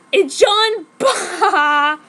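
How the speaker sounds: loud, high, slow cackling taunt; hammed-up monster hiss. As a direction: jeer at her like demons. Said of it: "they hissed at me".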